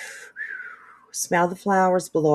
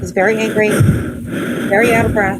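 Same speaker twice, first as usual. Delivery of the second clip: excited; 3 semitones higher, 7 dB louder, faster than usual. Real, not acted. Excited commentary.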